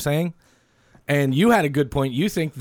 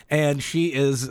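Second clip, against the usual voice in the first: put-on Southern accent; much slower than usual.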